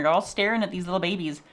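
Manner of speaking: normal talking voice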